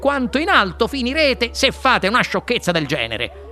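lively explanation rising to a high warning; animated inventor. A narrator's reading. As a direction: explain eagerly, then caution them up high. Wonka explains and warns. (inventore vivace che spiega e mette in guardia)

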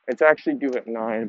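usual speaking voice

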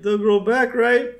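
high, loud, hopeful asking; hopeful Homer. Retold or imitated. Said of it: "And Homer's like".

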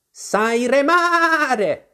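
very high, long-drawn question; demanding sheep. Acted. The sheep asks Alice to row, high drawn-out demand. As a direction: stretch the vowels out high like a bossy sheep.

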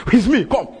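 loud, high defiant call; bold challenge. Acted. Christ answering those who came to arrest him, bold.

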